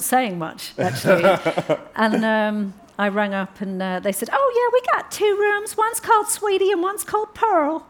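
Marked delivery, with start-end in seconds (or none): 4.3-7.8 high, sing-song host on the phone; lightly imitated friendly landlady; chirpy landlady voice. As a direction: play the cheerful B&B owner, pitched up. B&B owner answering the phone.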